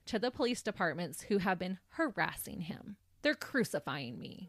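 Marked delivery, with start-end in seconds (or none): 3.2-4.5 low, slow imitation of a martyr; mock woe-is-me; mock self-pity. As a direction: play the victim, slow and low. Mocking the man's self-pity.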